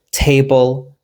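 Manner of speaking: "Table" with stress on TA; TA-ble, second syllable left weak; stress on first syllable. Shown as a demonstration. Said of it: "we stress the first part".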